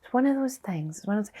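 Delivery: a high, mocking imitation of people's platitudes. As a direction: parrot the stock phrase up high, mocking it. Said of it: "people go".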